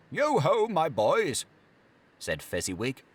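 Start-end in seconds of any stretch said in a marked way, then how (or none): 0.1-1.4 much higher, louder hearty hail; jolly master calling the lads; jovial call. Acted. Fezziwig calls his apprentices.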